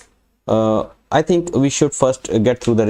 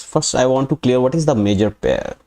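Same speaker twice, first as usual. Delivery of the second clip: ordinary delivery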